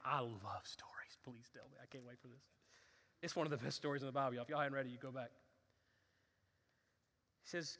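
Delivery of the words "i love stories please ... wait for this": put-on childlike excitement, whispered; eager whisper. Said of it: "And David's like".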